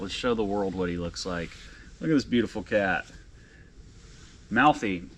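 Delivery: ordinary talk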